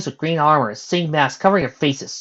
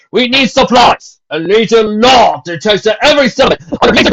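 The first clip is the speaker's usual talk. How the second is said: stern captain; 11 semitones higher, 13 dB louder than usual. A narrator's reading. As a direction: lay down the law to the crowd. Captain addresses the crowd.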